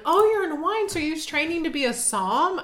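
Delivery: high, sing-song mock of a know-it-all; a touch of a mocking snob; mocking people. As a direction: sneer it like a snob asking. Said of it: "I hate it when people are like".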